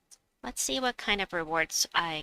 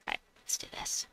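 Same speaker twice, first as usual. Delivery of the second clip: whisper; 10 dB quieter than usual. Real, not acted. Gearing up to act.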